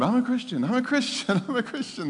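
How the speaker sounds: high, giddy re-enactment of a new convert; retold eager announcing. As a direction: gush it to everyone in a high voice. New convert telling everyone, retold.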